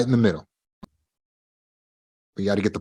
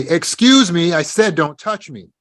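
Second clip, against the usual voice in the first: higher, louder demand to stop; higher, louder firm warning; raised voice; 7 semitones higher, 4 dB louder than usual. Retold or imitated. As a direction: raise the voice so bystanders hear. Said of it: "raise her voice and say".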